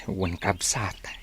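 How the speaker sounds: slow, hushed whisper; slow secretive whisper to a brother. Acted. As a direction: whisper it so no one overhears. Secret talk between two brothers.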